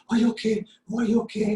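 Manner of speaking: high, loud, alarmed fussing; panicky parent. Retold or imitated. Parent rushing to a toddler who fell, panicked and high. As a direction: fuss over the fallen child like a frantic parent. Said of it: "the parents go".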